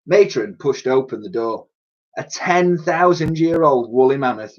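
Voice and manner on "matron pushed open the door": plain reading voice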